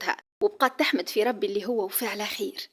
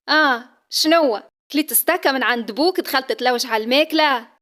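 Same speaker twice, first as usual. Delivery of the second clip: teasing mother; 3 semitones higher, 7 dB louder than usual. Acted. Mother teases daughter.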